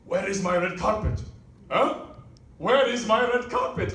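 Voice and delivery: demanding mock king with a Ugandan accent; quick, indignant royal demand, imitating a Ugandan accent; thick Ugandan accent. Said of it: "he comes up to the cashier and he says".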